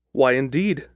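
plain reading voice

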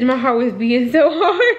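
nervous laugh. Real, not acted. Nervous over boyfriend's text.

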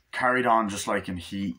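ordinary talk

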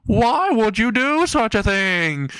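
high, slow, drawn-out wail; hammed-up horrified question. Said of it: "in a horrified face and says".

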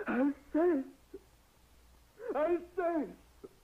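high, loud, drawn-out wail of her name; anguished cry. Acted. Widower calls out to his dead wife, anguished crying out.

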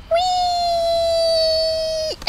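long high gleeful cry; excited whee. Pretend. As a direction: squeal with joy on the way down. Sliding down happily.

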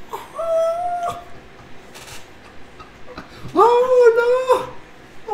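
very high, drawn-out wail; mock dismay. Father retelling finding his daughter's cut hair, mock wail. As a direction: imitate a distraught, whimpering "oh no".

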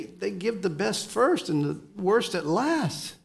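ordinary delivery

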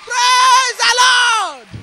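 high, slow, loud shout of praise. Real, not acted. Testifier calls the church to praise, slow loud shout.

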